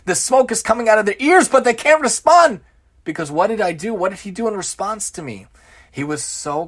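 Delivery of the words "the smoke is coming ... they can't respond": 1 mock fury. Describing boss's fury, voiced.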